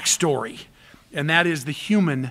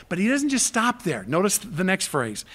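ordinary talk, nothing marked

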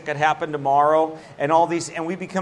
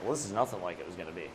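much lower, much softer, fast mumble; relieved aside; 9 semitones lower, 13 dB quieter, faster than usual. Retold or imitated. Relieved after the meeting, low quick mumble. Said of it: "It's like".

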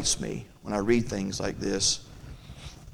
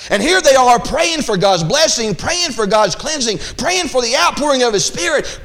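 high, loud fervent sermon delivery; full-force preaching; 13 semitones higher, 5 dB louder than usual. Acted. Preacher to congregation, fervent high loud preaching. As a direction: preach it out with fire.

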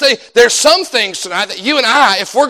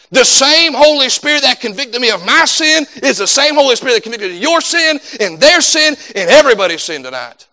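higher-pitched, emphatic sermon delivery; impassioned preaching; 4 semitones higher than usual. Acted. Preacher telling church of one Holy Spirit, impassioned. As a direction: drive each point home to the congregation.

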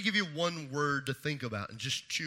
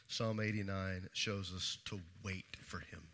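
whisper; 10 semitones lower, 14 dB quieter than usual. Acted. Third point delivered hushed.

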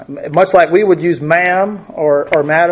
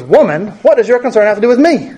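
curt, dismissive "Woman"; rude, impatient demand; 5 semitones higher, faster than usual. Shown as a demonstration. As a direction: snap "Woman, what does your concern have to do with me" faster and higher. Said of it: "he said it like that".

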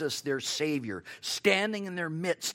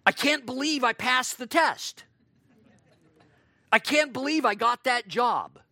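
higher, mildly excited "I can't"; mock joyful surprise, lightly played; a touch of happy amazement; delighted disbelief; 5 semitones higher than usual. Shown as a demonstration. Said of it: "This is like our expression".

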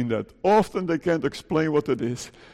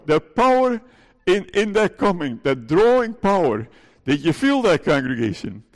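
fervent preaching; 4 semitones higher than usual. Acted. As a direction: lift the hall with each power. Preacher stirs up the congregation.

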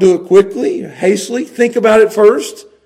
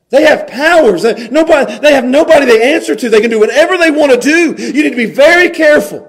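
louder, faster, rising sermon warning; fiery preaching; 7 semitones higher, 7 dB louder than usual. Acted. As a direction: hammer the warning home. Preacher builds to a climax.

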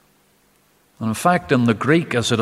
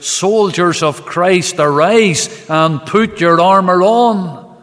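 lifted, slow, ringing recitation of a hymn; declaimed hymn; 6 semitones higher, 6 dB louder, slower than usual. Acted. Preacher opening Bible study with Wesley's hymn, slow ringing declamation. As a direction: proclaim the hymn's opening like a call to arms.

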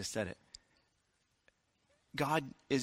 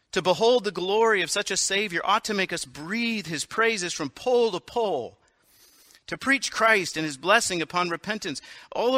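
higher, louder, preaching fervor; fervent declaration; 4 semitones higher, 4 dB louder than usual. Acted. Countess urging Whitfield to preach, with rising fervor. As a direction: proclaim it like a revival preacher.

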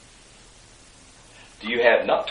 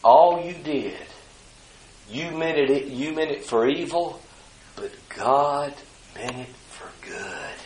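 hushed forgiving reply; 6 dB quieter than usual. Retold or imitated. Joseph answering his brothers, hushed and forgiving. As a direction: let it sink to near nothing by the end.

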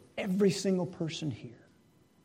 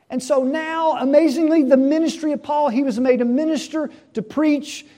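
higher and louder, driving sermon delivery; preaching at full force; 8 semitones higher, 8 dB louder than usual. Acted. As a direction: preach it out to the whole congregation.

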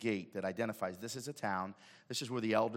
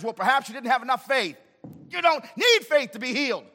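high, loud, impassioned sermon; full-force preacher's cry; fiery preaching; 16 dB louder than usual. Acted. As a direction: preach it hard to the crowd.

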